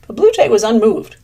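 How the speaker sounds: plain reading voice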